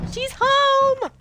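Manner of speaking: high, loud sing-song welcome; mock cheerful greeting. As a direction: chirp it like greeting a dear guest.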